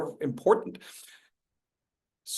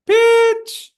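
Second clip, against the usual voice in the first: high pitch demo; 8 dB louder than usual. Shown as a demonstration. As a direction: pitch jumps way up on Pitch. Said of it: "talking about pitch".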